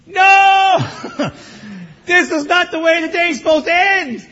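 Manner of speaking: high, loud cry with a laugh; dismayed outcry. Retold or imitated. Pastor hearing the AC broke, loud laughing outcry. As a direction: wail in mock despair.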